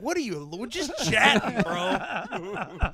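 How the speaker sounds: laughing impression of a bro; mocking bro voice. Host imitates co-host brushing off.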